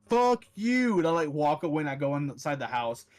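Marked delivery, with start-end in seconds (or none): 0.1-1.0 loud, higher snapped fuck you; a quick angry shout; retold yell. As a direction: spit it out at the kid on the ground. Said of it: "And I'm like".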